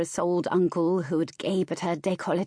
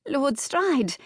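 high, squeaked-out greeting; startled squeak; 8 semitones higher, 3 dB louder than usual. A narrator's reading. Flustered hostess greeting her noble guest, squeaking. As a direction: squeak the name out, pitched well up. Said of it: "she finally squeaked out".

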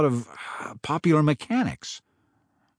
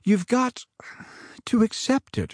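halting, grieving mother; a touch of a mother choking up; stammering mom; 9 semitones higher than usual. A narrator's reading. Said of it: "her mom's voice stammered".

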